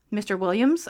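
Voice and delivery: ordinary talk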